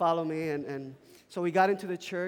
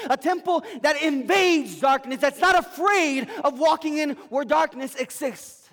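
loud, higher preaching cry; full-force preaching; 9 semitones higher, 11 dB louder than usual. Acted. Pastor preaching hard to the congregation, loud and fervent. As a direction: preach it out with fire.

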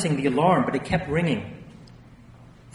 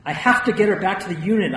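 loud, raised, urgent plea; panicked cry; 5 semitones higher, 6 dB louder than usual. A narrator's reading. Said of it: "I cried".

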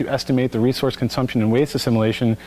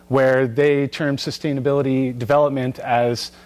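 ordinary talk

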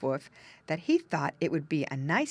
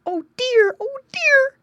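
very high, loud squealing oh dear; a piglet squealing in fright; high loud scared squeal; frightened squeal; 12 dB louder, slower than usual. A narrator's reading. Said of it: "squealed Squinty".

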